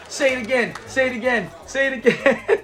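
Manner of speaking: daring Fred. Retold or imitated. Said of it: "And he's all".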